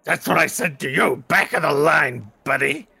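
loud, pushy queue challenge; confrontational. Acted. Orc confronts someone in line, loud and pushy. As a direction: order them back with force.

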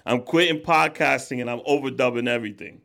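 ordinary delivery

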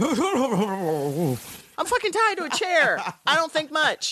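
Dawn's retort. Retold or imitated. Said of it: "It's like".

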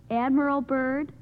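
ordinary talk, nothing marked

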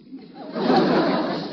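big booming belly laugh; hearty laugh. Acted. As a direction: roar with laughter. Gildersleeve laughs at Leroy's joke.

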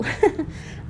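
a quick, airy laugh under the breath; short breathy laugh. Real, not acted. Laughing at herself about not training for the trek. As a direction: laugh it off lightly.

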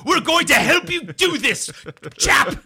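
mock British cheer, high and loud; hammed-up chummy British blokes rallying; excited Brits. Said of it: "the Brits get very excited about that stuff".

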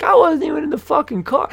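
very high, loud mock protest; indignant protest. Passenger protesting to the ranting officer, high indignant voice. Acted protest at being berated.

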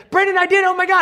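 mock a thrilled fan bursting with pride; a fan proudly reporting a kale photo, squealing. Fan gushing over kale photo.

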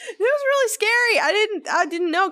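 high squeal easing down; flustered squeal. Real, not acted. Friend defending a silly fright, squealing then settling. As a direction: start squeaky, settle as you explain. Explaining the scare, still squealing.

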